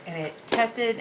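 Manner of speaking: ordinary talk, nothing marked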